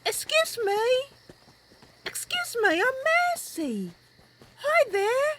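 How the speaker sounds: friendly adventurer greeting politely; Mercy introducing herself to a stranger; Mercy's voice. Acted. Mercy voice held.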